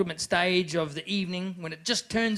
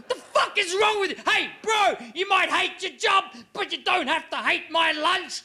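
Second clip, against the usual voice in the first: much higher, loud furious rant; raging shout; 10 semitones higher, 3 dB louder than usual. Retold or imitated. Yelling at Travis over the lettuce, furious shout. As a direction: lose it completely at the kid.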